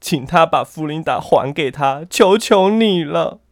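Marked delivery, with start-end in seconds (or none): 2.1-3.4 pleading. Acted. Pleading to the witch.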